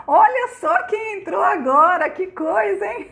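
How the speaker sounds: high excited greeting breaking into laughter; delighted surprise. Real, not acted. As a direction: marvel at a surprise guest, voice up high. Delighted at who just joined. (surpresa animada, voz aguda e risonha)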